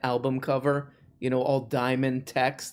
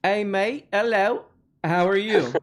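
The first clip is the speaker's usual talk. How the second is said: high-pitched hammed-up British greeting; exaggerated British accent, pitched up; bad British accent; 5 semitones higher than usual. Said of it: "our horrible versions of how".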